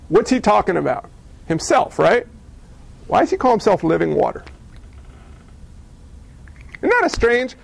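usual speaking voice

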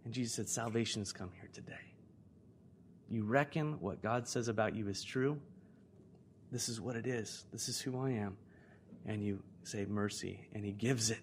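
low, soft, reverent voice; hushed, sincere assurance; quiet pastoral appeal. Real, not acted. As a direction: speak salvation gently over them. Quiet pastoral appeal about salvation.

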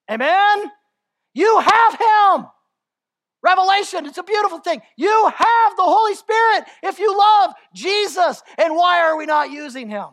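high, loud preacher's shout; fiery sermon climax; full-force preaching. Real, not acted. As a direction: preach it hard to the room.

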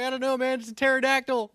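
high, flustered retold answer; mock panic. Retelling his answer to buddies during the shooting, panicked. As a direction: babble it in comic alarm.

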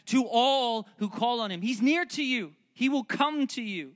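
high, ringing, insistent sermon voice; rising preacher's fervour, each promise pushed harder; fervent preaching. Acted. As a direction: proclaim it to the whole room. Preaching at full force.

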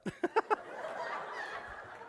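a burst of high, airless laughter; high breathy laugh. Real, not acted. Pastor cracking up at the guard's story, laughing. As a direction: laugh up high, almost silent.

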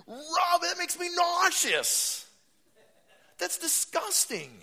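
very high, loud, slow mock revulsion; over-the-top high-pitched gagging disgust; hammed-up disgust. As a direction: squeal it out like it makes you sick. Exaggerated disgust at cartoons.

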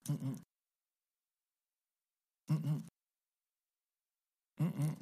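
barely audible car chirp; quiet imitated beep. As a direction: hum the faint unlock beep quietly. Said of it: "it was real quiet".

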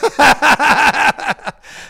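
big high burst of laughter; loud laugh. Real, not acted. Pastor laughs at his own joke, loud. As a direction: roar with laughter.

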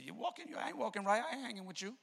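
mock sanctimonious scolding; religious attitude. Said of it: "somebody with a little religious attitude".

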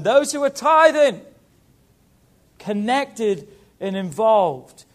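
full-force preaching crescendo, slow and rising; preaching build. Acted. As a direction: build the list to a peak on "tithing". Sermon builds on "those who are.

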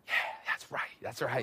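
soft, satisfied whisper to himself; whispered approval while driving past the wall. Retold or imitated. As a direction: quietly gloat under your breath.

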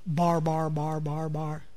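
dull, low repetitive babble; imitating foreign babble. Shown as a demonstration. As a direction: drone the syllables evenly like meaningless speech. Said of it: "it sounded like this".

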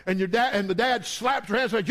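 usual speaking voice

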